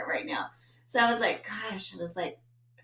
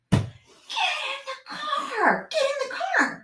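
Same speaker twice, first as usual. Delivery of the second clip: high, strained mock scream, first one drawn out; retold yell; 3 dB louder, much slower than usual. Mom at the end of her rope in the parking lot, yelling. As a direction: shriek the order, hammed up. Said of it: "like, yells back to me".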